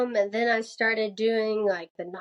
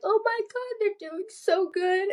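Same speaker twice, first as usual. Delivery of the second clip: much higher, squealing with delight for others; gushing excitement; 11 semitones higher than usual. Retold or imitated. Runner gushing at fellow cyclists on a ride. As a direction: gush over the other riders. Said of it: "I'm like".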